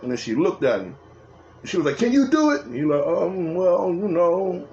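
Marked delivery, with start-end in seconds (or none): 2.0-2.6 high-pitched challenge, acting out a sister; imitated pushy question; challenging demand. Sister challenging the sweating mechanic. 3.1-4.7 mock-hesitant mechanic, higher pitch; acted-out uneasy hedging; hesitant stalling. Mechanic dodging sister's challenge, sweating.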